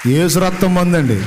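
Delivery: loud, with a hard fall on రక్తం; preaching shout; loud proclamation. Acted. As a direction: declare it to the whole church. Preacher proclaims the woman's answer.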